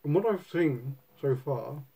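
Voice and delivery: talking around pipe stem; speaking with clenched teeth; pipe in mouth. Real, not acted. Seen 1: Pipe stem held in mouth while speaking, removed at 4.0s.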